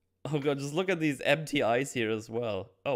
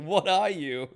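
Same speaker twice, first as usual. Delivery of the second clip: higher, louder frightened question; mock fear; 6 semitones higher, 5 dB louder than usual. Geppetto facing the puppet, mock fearful cry. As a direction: ask it like a scared old man.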